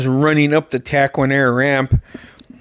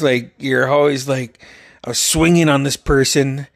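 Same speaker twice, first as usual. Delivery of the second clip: normal talking voice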